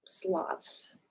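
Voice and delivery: soft breathy whisper. Pretend. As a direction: whisper it like a sleepy sloth. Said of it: "So we're gonna practice being like".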